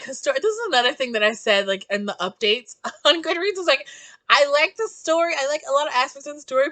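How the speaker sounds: ordinary talk, nothing marked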